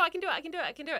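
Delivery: ordinary talk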